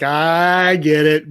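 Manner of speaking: long, slow stretched "I"; slow mock realization; drawn-out realization. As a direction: stretch it as if the joke is dawning. Imagined smile of dawning recognition.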